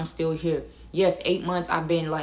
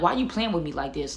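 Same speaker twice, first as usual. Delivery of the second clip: ordinary delivery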